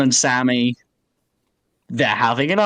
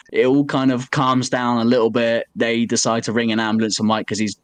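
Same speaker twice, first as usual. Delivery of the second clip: ordinary delivery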